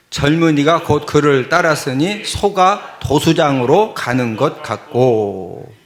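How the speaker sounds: firm Bible recitation; scripture reading. Real, not acted. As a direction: recite it for the congregation, drawing out the ending. Reads verse with congregation.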